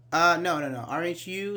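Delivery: normal talking voice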